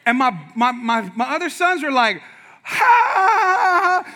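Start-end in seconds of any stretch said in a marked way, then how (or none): 2.6-4.0 one sharp high burst of a laugh; loud mocking ha. Brothers cracking up at the kid's slip, loud ha. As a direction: bark out a loud ha.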